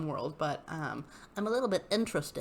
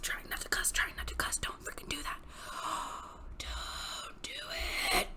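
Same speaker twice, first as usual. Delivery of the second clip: stifled unvoiced whisper; 10 dB quieter than usual. Real, not acted. To herself, stifling a curse, whispered. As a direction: whisper it, holding back a curse. Suppressing laughter, trying not cuss.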